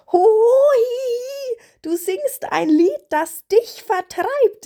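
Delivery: high, loud, gleeful raven voice; cawing raven call. A narrator's reading. Raven teases spirit about his song, calling out. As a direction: cry it out high, tickled by the joke. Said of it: "rief er".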